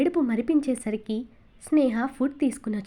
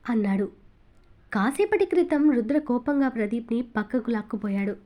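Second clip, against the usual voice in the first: usual speaking voice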